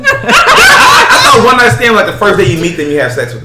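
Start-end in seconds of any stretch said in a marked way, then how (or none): 0.0-1.9 laughing through the words, pitch way up; amused laugh on the line. Real, not acted. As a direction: giggle it out. Laughing while recalling the idea.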